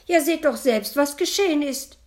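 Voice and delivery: high, loud, indignant scolding; shrill-free loud high rant; scolding outburst. A narrator's reading. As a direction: launch into an angry tirade. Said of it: "legte die Schwiegermutter los".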